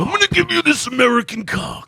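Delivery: shouted high wrestler boast; wrestling promo. Pretend. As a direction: yell it like a wrestling heel. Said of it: "Macho Man Randy Savage cutting a promo".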